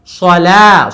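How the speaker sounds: usual speaking voice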